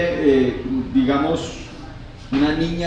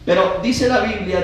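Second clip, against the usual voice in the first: ordinary talk, nothing marked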